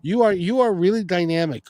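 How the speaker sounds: ordinary talk, nothing marked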